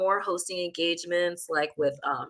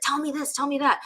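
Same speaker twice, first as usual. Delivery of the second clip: higher-pitched, insistent nagging of a sibling; mock pestering; 8 semitones higher than usual. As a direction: imitate yourself pestering your little sister. Retold pestering of her sister.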